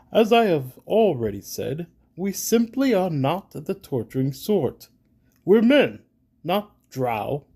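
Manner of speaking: proud warrior, rising to a loud protest; stiff, offended nobleman; indignant knight. Acted. GM voices indignant Reginald.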